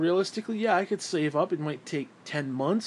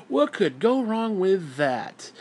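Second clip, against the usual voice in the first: slow, drawn-out sarcastic question; 3 semitones higher, much slower than usual. Acted. Ranter jeers at trusting big corporations, slow and sarcastic. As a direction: stretch it out in mock innocence.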